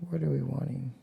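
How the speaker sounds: usual speaking voice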